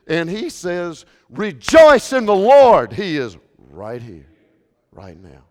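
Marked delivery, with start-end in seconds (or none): 1.3-2.9 slow, booming call to rejoice; slow and loud on rejoice; loud proclamation. Acted. Proclaims the apostle's words. 2.9-5.4 quiet intimate assurance. Acted. Drops after the proclamation.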